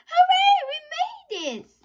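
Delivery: high, loud cheer; joyful cheer. A narrator's reading. Asia cheering as the lorry escapes. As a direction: cheer out in delight. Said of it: "laughed Asia".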